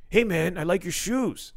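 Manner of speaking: impression of Dante. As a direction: mimic a friendly stranger in the lobby, drawn out. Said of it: "That was my impression of Dante Chang".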